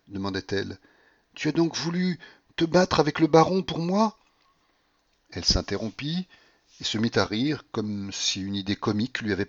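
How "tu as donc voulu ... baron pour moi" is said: high, excited woman's question; pitched-up, impassioned asking; feverish wonder. A narrator's reading. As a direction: marvel at the devotion. Delirious Paulina questions her lover.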